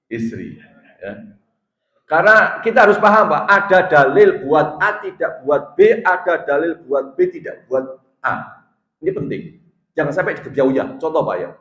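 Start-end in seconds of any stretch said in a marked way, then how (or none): 2.1-8.6 raised, deliberate sermon voice; emphatic preaching. Acted. Preacher explaining whom each proof text addresses, emphatic preaching. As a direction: spell out the principle with force.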